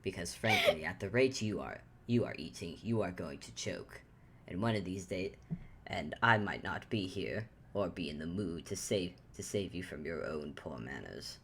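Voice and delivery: low, quiet, stern scolding; stern lecture. Acted. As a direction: lecture her dryly, low. Antler lectures Ruby sternly.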